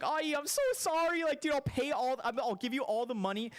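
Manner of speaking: put-on apologising to the girlfriend's mom after wrecking her car, frantic and high; frantic apology. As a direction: beg forgiveness in a high panic.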